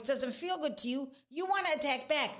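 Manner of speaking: normal talking voice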